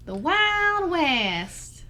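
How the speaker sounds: slow cowboy twang, stretched out; Wild West drawl. Pretend. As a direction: drag it out like a cowboy. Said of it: "Is it like a".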